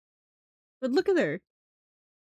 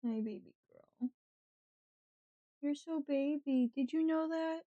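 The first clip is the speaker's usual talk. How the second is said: gentle pet talk, mostly soft; 3 semitones higher, 4 dB quieter than usual. Real, not acted. Cooing baby talk to cat.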